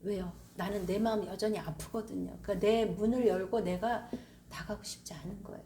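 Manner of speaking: usual speaking voice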